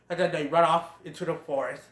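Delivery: ordinary talk, nothing marked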